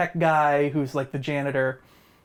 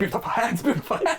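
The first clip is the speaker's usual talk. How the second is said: rising, laughing mock teacher; teacher chasing the kid, hammed up; hammed-up creepy teacher; 6 semitones higher than usual. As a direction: ham up the creepy teacher's chant. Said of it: "he was clearly hamming it up".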